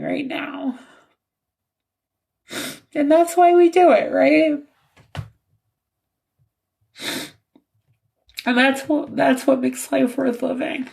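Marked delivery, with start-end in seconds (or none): 2.9-10.9 crying. Real, not acted. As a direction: speak through tears. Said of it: "I'm probably gonna keep crying".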